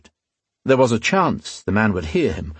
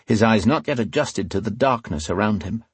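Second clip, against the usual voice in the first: ordinary delivery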